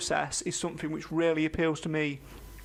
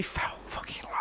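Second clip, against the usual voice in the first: whispering; 10 dB quieter than usual. Real, not acted. Aside muttered under breath.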